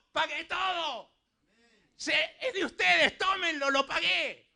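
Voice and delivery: high-pitched preacher's shout in Christ's role; acted cry. Preacher acts Jesus crying from the cross, acted shout. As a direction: cry it out high, as a triumphant declaration. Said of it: "Él gritó desde la cruz".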